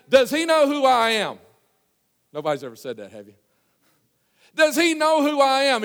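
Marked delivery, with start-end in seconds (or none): 0.1-1.4 high, loud, slow outrage; acted-out haughty outburst, every word drawn out; proud indignation. 4.5-5.8 high, loud, slow outrage; acted-out haughty outburst, every word drawn out; proud indignation. Proud Naaman's indignation repeated.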